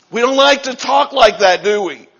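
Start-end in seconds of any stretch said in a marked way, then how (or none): none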